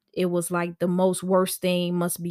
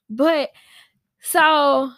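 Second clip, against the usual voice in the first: ordinary talk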